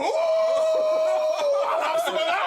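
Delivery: high, slow whoop with laughter; delighted shout. Real, not acted. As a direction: squeal over a welcome gift. Delighted at a gift.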